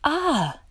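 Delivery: high startled shriek. A narrator's reading. Stanley facing the shark's mouth, a high shriek. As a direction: gasp it out, terrified. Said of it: "Stanley shrieked".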